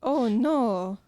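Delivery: put-on Mario-style Italian accent, slow; hammy Italian plumber accent. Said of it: "That's a really good Mario impression".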